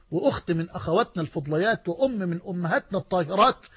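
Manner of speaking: ordinary talk, nothing marked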